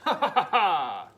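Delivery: loud, slow hammed-up victory laugh; triumphant laugh. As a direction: cackle in triumph.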